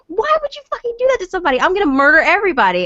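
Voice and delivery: furious rant. Retold or imitated. Enraged after being grabbed, yelling at the staff, fast angry shout. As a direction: spit it out fast, furious and loud. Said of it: "I was pissed off".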